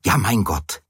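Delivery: ordinary delivery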